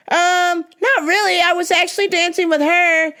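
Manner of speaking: high, hesitant imitated girl; mock girl's voice, awkward refusal; woman declining.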